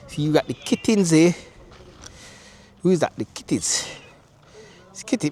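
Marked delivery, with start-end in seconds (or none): none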